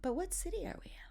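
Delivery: high, hushed imitation of a lost woman; confused bus passenger. Woman on the bus looks at speaker, softly bewildered. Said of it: "looks at me and she goes".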